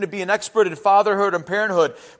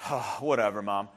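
put-on dismissive teen; 12 semitones lower, 11 dB quieter than usual. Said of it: "and it's like".

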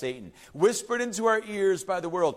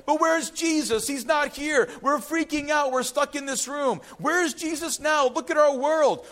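higher, panicky cries of where's Jesus; frightened disciples; 6 semitones higher than usual. Acted. Preacher voicing panicked disciples in the locked room. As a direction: play the scared disciples hiding in the room.

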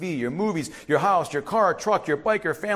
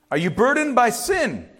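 ordinary talk

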